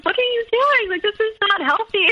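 high, hammed-up disbelief at a friend's scheme; exasperated younger self. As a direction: squeak it out like a stunned, disapproving friend. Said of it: "And I was always like".

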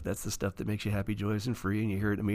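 usual speaking voice

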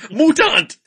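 loud, high gusto-filled yell; trailer shout. Retold or imitated. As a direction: bellow it like a trailer narrator. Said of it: "the narrator puts such gusto".

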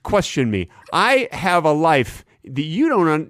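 ordinary talk

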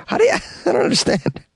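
laughing in disbelief. Real, not acted. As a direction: giggle through it.

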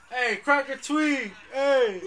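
loud, high mock yell, slow; mocking cops rousting a homeless guy; mock cop shout. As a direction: shout at someone eating an apple.

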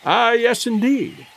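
plain reading voice

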